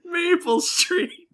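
very high, crying, fast rambling; weepy whine. Acted. Jilted girlfriend tells the adventurers about her boyfriend, weeping. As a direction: snivel out what you remember.

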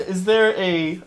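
ordinary talk, nothing marked

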